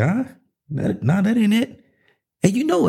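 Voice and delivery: a light imitation of God, high on the last words; God dismissing it. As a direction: play God waving it off casually. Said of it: "And God's like".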